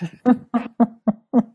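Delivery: loud, bursting laugh; real burst of amused laughter. Real, not acted. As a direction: laugh out loud at your own confession. Laughs about her unpaid taxes.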